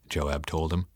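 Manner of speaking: usual speaking voice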